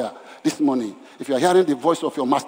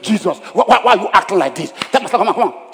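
complaining; 5 semitones higher, 5 dB louder than usual. Acted. Martha complaining to Jesus.

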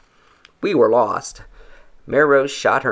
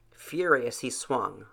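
ordinary delivery

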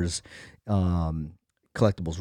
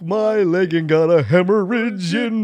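movie character voice; 8 semitones higher, 5 dB louder than usual. Retold or imitated. Quoting movie line in voice.

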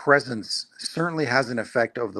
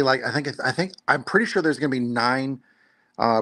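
normal talking voice

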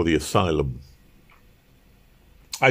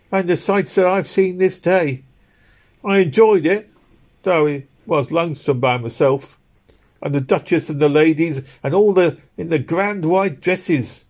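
much higher, louder woman of the streets, full of wonder; London working woman; 10 semitones higher, 4 dB louder than usual. A narrator's reading. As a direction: recall grand sights in a poor woman's voice.

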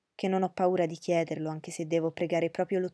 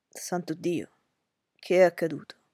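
startled male voice, louder on the question; Darcy alarmed at her pallor, startled and urgent; passionate exclamation; 4 dB louder than usual. Acted. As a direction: burst out in alarm. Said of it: "esclamò Darcy con passione".

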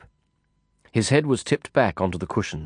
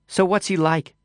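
lightly voiced woman; 10 semitones higher than usual. Woman asks about kid.

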